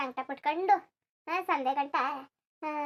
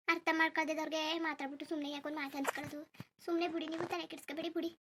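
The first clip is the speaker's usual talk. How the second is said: quiet resigned aside; 9 dB quieter than usual. Acted.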